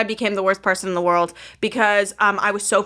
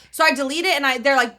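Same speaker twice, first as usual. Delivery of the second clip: usual speaking voice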